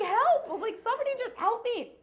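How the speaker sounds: high, loud pleading cry for help; desperate plea. Retold or imitated. Overwhelmed sitter at the vet, loud high plea. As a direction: beg anyone nearby, loud and high.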